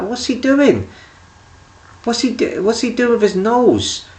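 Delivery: high, amazed asking; baffled. Retold or imitated. Watching his friend wipe his nose, high amazed disbelief. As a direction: gape at what he's doing. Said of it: "putting the wipe on his nose".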